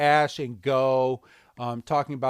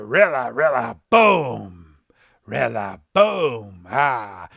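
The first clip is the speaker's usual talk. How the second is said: Rillaboom call; 4 semitones lower, 6 dB louder, slower than usual. Retold or imitated.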